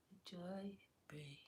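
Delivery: soft whisper; whispered afterthought. Real, not acted. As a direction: breathe it out gently. Whispered aside.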